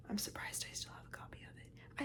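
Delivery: hushed secret whisper; breathy aside to the camera. Real, not acted. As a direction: whisper it like a confession. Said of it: "Why am I whispering?".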